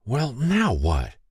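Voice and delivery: ordinary delivery, nothing marked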